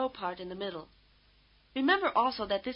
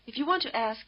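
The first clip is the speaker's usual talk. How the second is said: ordinary talk, nothing marked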